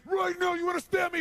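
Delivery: high, loud, unhinged yell; wild bullying shout; crazed screaming demand. Retold or imitated. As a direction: scream it like a madman. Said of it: "I start acting crazy".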